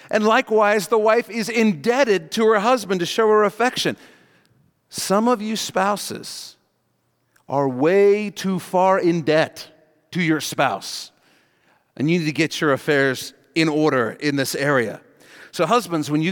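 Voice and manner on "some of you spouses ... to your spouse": slow emphasis. Real, not acted. Pastor scolds slow for emphasis.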